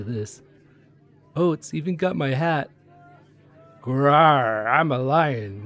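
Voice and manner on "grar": louder mock growl. Conductor playing lion in costume, mock growl. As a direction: snarl like a cartoon lion. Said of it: "lion costume play".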